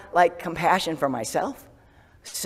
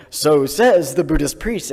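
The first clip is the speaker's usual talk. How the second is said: slow, louder mock of a teasing husband; Tom's dry jab; 8 dB louder, slower than usual. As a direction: drawl it out like a wry husband poking fun. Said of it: "Tom will be like".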